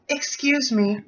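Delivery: put-on high-pitched, polite anime girl. New student approaching her professor, anime girl voice. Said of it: "just do the anime girl voice".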